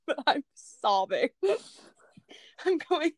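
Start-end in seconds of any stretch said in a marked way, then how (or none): none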